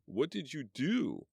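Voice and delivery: hurt man calling from a wreck; groaning call. Acted. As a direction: drag it out in pain. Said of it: "Lloyd called".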